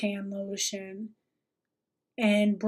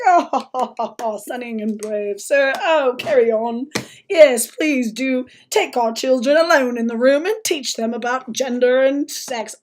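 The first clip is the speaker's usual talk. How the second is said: loud, sarcastic gushing approval; mocking fawning voice; 7 dB louder than usual. Mocking people who praise the guest, loud sarcastic gushing. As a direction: gush with mock admiration, pushing it loud. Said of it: "and everyone's like".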